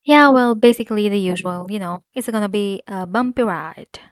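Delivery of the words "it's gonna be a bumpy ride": ordinary talk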